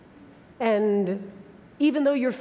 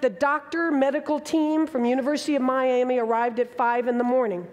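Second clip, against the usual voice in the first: normal talking voice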